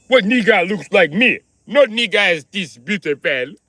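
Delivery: hammed-up vain boast; vain indignation. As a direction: drag it out, offended at the idea. Vain denial in the bit.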